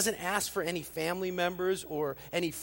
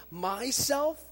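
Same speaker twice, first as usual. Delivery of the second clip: "Myself" high, loud, rising in disbelief; stress on myself; 8 semitones higher, 7 dB louder than usual. Shown as a demonstration. Preacher quoting verse nineteen, incredulous rising "Myself". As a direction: punch "Myself" with incredulous lift. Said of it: "You might have heard it in my inflection".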